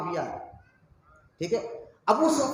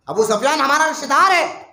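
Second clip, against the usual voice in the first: accusing shout; 7 semitones higher, 6 dB louder than usual. Acted. Abbas accusing Umar.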